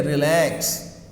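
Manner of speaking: relax with the second syllable stressed and drawn out. Shown as a demonstration. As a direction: stretch the end of relax to show its stress. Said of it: "you have the stress here".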